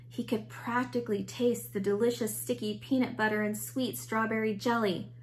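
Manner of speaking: usual speaking voice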